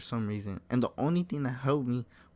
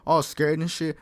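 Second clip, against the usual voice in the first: normal talking voice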